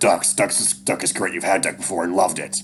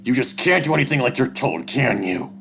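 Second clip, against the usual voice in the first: angry father, loud and higher; loud, exasperated parental telling-off; raised voice; 7 semitones higher, 9 dB louder than usual. A narrator's reading. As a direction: scold him with the voice raised. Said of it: "Dad's voice raises".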